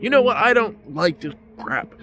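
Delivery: high, loud, mock-angry snap; mock angry outburst. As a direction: imitate her storming off in disgust. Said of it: "she gets really mad".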